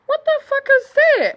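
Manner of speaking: high, loud disbelief; acted-out shock, pitched right up; shocked reaction. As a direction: exclaim it in high-pitched horror. Acting shock at the video.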